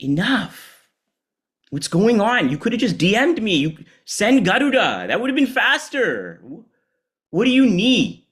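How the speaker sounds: high, loud exasperated buddy voice; exasperated Shiva. Acted. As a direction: scold a friend, high and fond. Shiva scolding Vishnu playfully.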